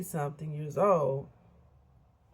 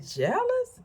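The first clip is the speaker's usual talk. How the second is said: high-pitched retold disbelief; incredulous echo; 3 dB louder than usual. Reacting to friend's jealousy confession, incredulous echo. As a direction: throw the word back in disbelief, way up high.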